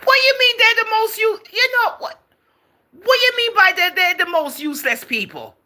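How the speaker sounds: high, loud, shocked demand; outraged disbelief. Real, not acted. Host to viewer Crazy about his insult, outraged high shout.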